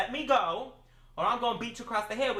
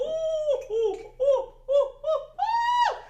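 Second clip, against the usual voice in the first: climbing shrieks of ooh, each higher and louder; rising squealing oohs; 5 dB louder, much slower than usual. Real, not acted. Reacting to her own roast of the cook, squealing higher oohs.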